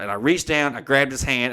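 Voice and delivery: normal talking voice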